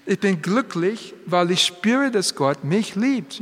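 ordinary talk, nothing marked

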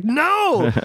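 a sudden, very high cry of dismay; anguished no. Retold or imitated. Actor hearing his favourite scene was cut, yelping in dismay. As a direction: wail no like a heartbroken fan. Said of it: "I was like".

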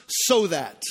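"so that" set apart and stressed; "so that" spoken slowly and clearly. Shown as a demonstration. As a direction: lean on "so that" as the key words. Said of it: "circle those words".

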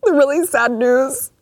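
high-pitched, hammed-up tearful voice; mock crying. Host breaking sad news on camera, fake crying. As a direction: pretend to choke up, squeaking through sobs. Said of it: "I didn't mean to cry on camera".

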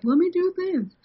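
high, sing-song mock voice; lightly mock a lazy designer. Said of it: "was like".

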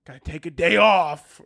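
fed-up Italian plumber, drawn-out grumble building to exasperated yells; grumble, yell in exasperation, then ease off grudgingly; put-upon working man's mock outburst; Mario, upset. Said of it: "he needs to be like".

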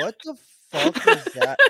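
high, incredulous exclamation; a fan reacting to a bizarre broadcast moment, high-pitched and incredulous; mock disbelief. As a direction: act out being totally baffled. Retelling own stunned reaction.

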